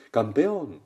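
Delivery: loud cheering call; father's acted shout. Father shouts again for his son, acted loud shout. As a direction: shout it out to the mountain. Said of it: "Vuelve a gritar el, el padre".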